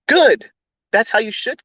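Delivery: high, loud approving good; emphatic approval. Real, not acted. Host approving viewers who didn't buy, loud. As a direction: bark out the approval.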